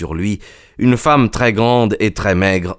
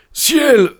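very high cry of alarm; panicked splutter; 3 dB louder than usual. A narrator's reading. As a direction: squeal it in panic. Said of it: "bredouilla-t-il". (cri aigu de panique)

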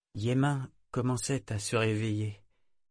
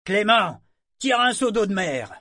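much higher, loud call; calling over shoulder; 11 semitones higher, 8 dB louder than usual. Acted. Mate calling to the sailor for seawater, loud call. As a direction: throw the order across the deck. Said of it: "il lança par-dessus son épaule".

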